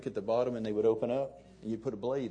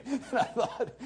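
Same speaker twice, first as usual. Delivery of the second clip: laughing through the words, real amusement; chuckling as he tells it; 4 semitones higher than usual. Real, not acted. Laughs at his own story.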